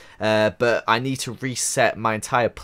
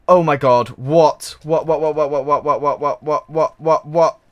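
rapid louder stream of alarmed whats; frantic panic; 4 semitones higher, 7 dB louder than usual. Real, not acted. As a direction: babble in rising alarm. House burning, frantic repetition.

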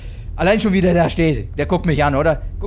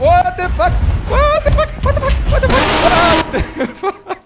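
much higher, louder panicked yelling, last one drawn out slowly; startled screams; 6 dB louder than usual. Real, not acted. Gamer startled by the monster, panicked repeated screaming. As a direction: shriek it again and again in real shock.

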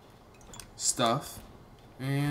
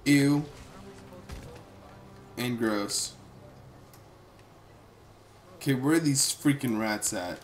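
ordinary talk

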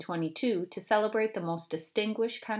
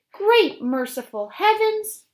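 very high, loud and slow; thrilled exclamation; 13 semitones higher, 10 dB louder, slower than usual. Acted. Mother hearing about the pie crust recipe, ecstatic exclamation. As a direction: gasp it to the heavens. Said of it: "Alice's mother exclaimed".

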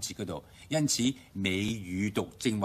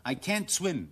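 I can't swim quicker, can't as kan without audible T; faster American reading; 8 semitones higher, slower than usual. Shown as a demonstration. Teacher showing the T vanish, faster reading. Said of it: "讀快啲".

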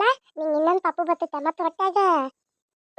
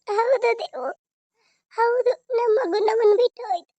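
grieving, voice pitched up in tears; tearful; 5 semitones higher than usual. Acted. Mother telling a child her baby is gone, crying. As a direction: cry through the words. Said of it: "ನೀ ಅಳ್ಬೇಡ".

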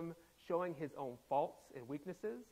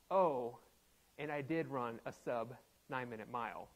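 casual humble brag; 7 semitones lower than usual. Acted. Runner slipping her race time into her complaints, casual humble brag. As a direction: drop it in low, as an offhand afterthought. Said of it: "she makes sure to drop in there".

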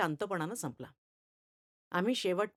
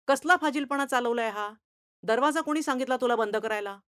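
angry scolding; 4 semitones higher, 6 dB louder than usual. Acted. Teacher scolds boy shutting door.

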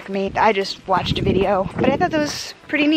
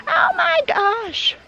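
high, slow gushing "oh my gosh" with a gasp; surprised lady; 10 semitones higher, much slower than usual. Retold or imitated. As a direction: imitate a delighted passerby. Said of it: "And she's like".

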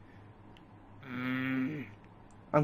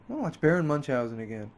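ordinary talk

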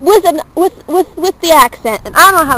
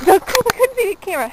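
higher-pitched, amused laughing voice; 7 semitones higher, 4 dB quieter than usual. Real, not acted. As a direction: say it through a real laugh. Laughing after boob shots remark.

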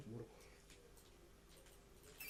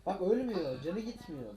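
loud, raised exasperation; frustrated shout; 7 semitones higher, 10 dB louder, slower than usual. Real, not acted. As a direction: shout it in disbelief.

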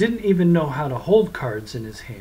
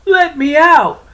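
even higher, louder desperate cry; louder shouting; 13 semitones higher, 12 dB louder than usual. Acted. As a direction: scream it louder still. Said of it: "cried, cursed".

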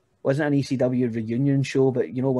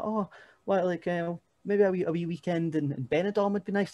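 higher, light imitation of a hopeful fiancée; fiancée's voice; 7 semitones higher than usual. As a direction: lightly mimic her suggesting a weekend away. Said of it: "then she'll be like".

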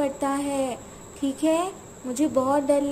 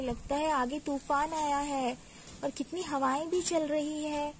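usual speaking voice

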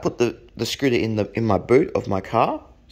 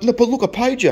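high, loud mock protest; imitated indignant plea, pitched right up; 13 semitones higher, 5 dB louder, faster than usual. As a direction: insist urgently like a frantic buyer. Buyer protests he paid.

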